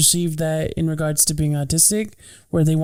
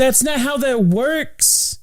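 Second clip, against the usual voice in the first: retold retort; 8 semitones higher, much slower than usual. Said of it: "And I'm like".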